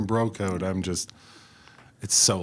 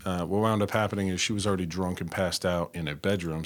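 normal talking voice

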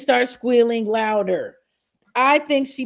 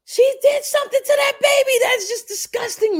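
much higher, louder and fast; outraged outburst; 12 semitones higher, 4 dB louder than usual. Real, not acted. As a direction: blurt it out in a shocked rush. Outraged at the baby clip.

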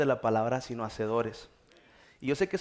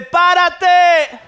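loud, high, stretched-out yell; shouted command; 16 dB louder than usual. Shown as a demonstration. Preacher modelling dad's line for congregation, loud shout. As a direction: shout it to rouse someone. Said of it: "Dile a la persona que está enseguida".

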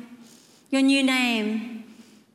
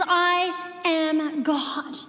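very slow, higher, weighty declaration; God declaring himself; 4 semitones higher, much slower than usual. Acted. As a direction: draw each word out as God. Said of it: "He says".

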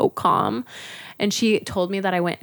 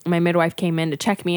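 usual speaking voice

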